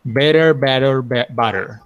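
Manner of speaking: "better" with a short e, then "butter" with an open a. Shown as a demonstration. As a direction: contrast "better" and "butter" clearly. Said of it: "please repeat after me".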